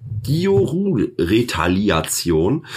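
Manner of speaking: ordinary talk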